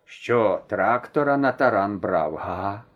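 a hint of a calm old grandfather, slowish; mildly teasing old man, unhurried. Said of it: "спокійно спитав".

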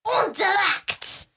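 mock French, said with force; aggressive. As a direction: bark the French word. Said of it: "that was very aggressive".

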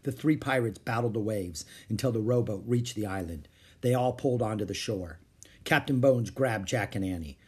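ordinary delivery

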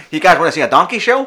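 high, loud dodgy tout; Dick Dastardly. Acted. Host offering guys a donkey show, sketchy tout voice. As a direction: hawk it loudly like a shady showman. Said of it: "trying to go like Dick Dastardly".

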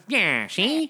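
lightly voiced old movie gangster; tough-guy mobster drawl with a grin. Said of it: "That's more gangster".

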